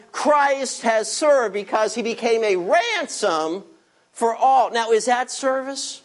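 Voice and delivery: ordinary delivery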